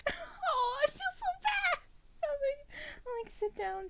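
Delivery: high, hammed-up crying wail; mock crying wail. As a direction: wail the guilt like a cartoon sob.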